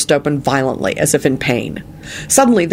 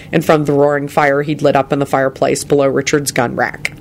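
usual speaking voice

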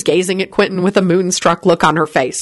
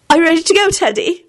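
high-pitched, slightly uneasy question; voice shaking; 11 semitones higher than usual. A narrator's reading. Elizabeth collecting Teddy after breakfast, voice shaking. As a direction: ask in a high, unsettled voice. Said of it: "she heard her voice shaking".

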